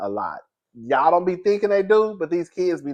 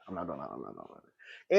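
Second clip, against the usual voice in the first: much lower, much softer trailing off; fading mutter; 13 semitones lower, 16 dB quieter than usual. Shown as a demonstration. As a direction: fade to a mutter.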